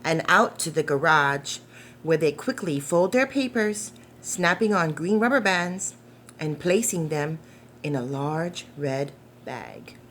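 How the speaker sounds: gentle storybook narration, unhurried; reading to children. Acted. Reading The Paper Boy aloud to young listeners, gentle storytelling. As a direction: read it like a bedtime picture book. Reads picture book aloud.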